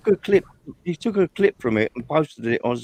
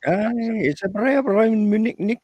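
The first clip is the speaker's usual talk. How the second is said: much higher, slower mock Scottish accent; 9 semitones higher, 4 dB louder, slower than usual. Friend mimicking a Scottish speaker, in a Scottish accent. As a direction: put on a thick Scottish accent. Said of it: "You're pretty good at that".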